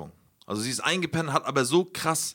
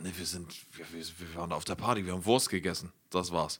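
low, soft, halting mumbled answer, imitated; hint of a dazed girlfriend piecing it together; lightly voiced girlfriend; 9 semitones lower, 6 dB quieter than usual. Boyfriend mimicking girlfriend recalling the party, low halting voice.

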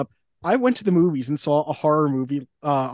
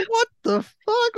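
high, slow, drawn-out "What the fuck?"; mock disbelief; much slower than usual. Reacting to the neighbor's line, slow high disbelief. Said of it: "I was like".

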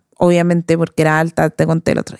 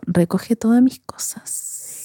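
slow, whispered, wounded order; hurt whisper; 3 semitones higher, 6 dB quieter, much slower than usual. Retold or imitated. The hurt girl orders the boy to pick up her things, slow whisper. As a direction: whisper it slowly, proud and hurt. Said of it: "Estaba dolida".

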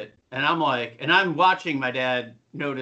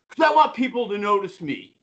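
put-on whiny teen brother; 7 semitones higher, 3 dB louder than usual. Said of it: "brother goes to my dad".

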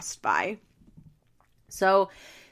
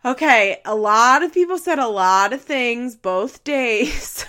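dodging deflection; 4 semitones higher, 6 dB louder than usual. Retold or imitated. Stefan dodging, voiced by host.

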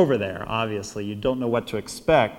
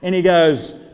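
usual speaking voice

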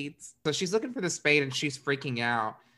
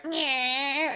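snarling nyeh; 12 semitones higher than usual. Retold or imitated.

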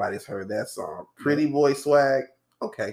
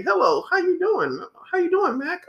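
much higher-pitched mock greeting; imitated coworkers. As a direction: greet Mac in a sing-out high voice. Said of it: "people ask me".

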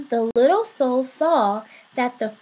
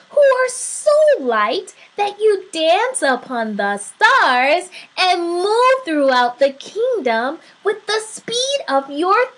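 lightly voiced little soul, lively and emphatic; bewildered little soul; 6 semitones higher, 5 dB louder than usual. A narrator's reading. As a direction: question with wonder, stretching the key words.

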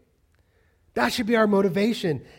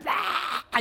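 shouted noise of exaggerated outrage; flipping-out noise; 5 semitones higher than usual. Dad ranting wordlessly at his daughter, flipping out. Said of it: "you flip out".

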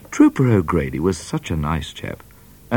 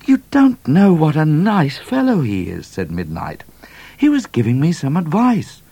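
higher, louder eager voice; eager Midnight; 10 semitones higher, 5 dB louder than usual. A narrator's reading. Midnight defending O'Grady to Cat, higher.

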